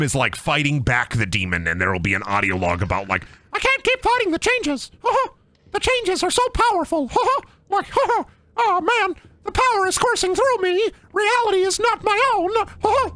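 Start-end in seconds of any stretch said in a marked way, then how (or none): 3.5-13.1 high, far-off tormented monologue; spooky villain voice. Pretend. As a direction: ham up a cursed creature. Mock villain audio log.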